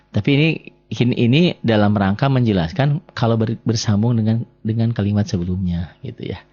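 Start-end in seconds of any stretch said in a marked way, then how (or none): none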